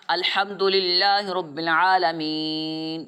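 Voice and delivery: slow, drawn-out Quran chanting; Quran recitation. Shown as a demonstration. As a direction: recite it slowly, stopping on the last word. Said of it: "آپ قرآن پاک پڑھ لیں".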